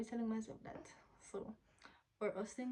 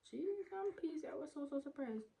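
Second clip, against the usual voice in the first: shocked exclamation; 7 semitones higher than usual. Real, not acted. Reacting to hair loss.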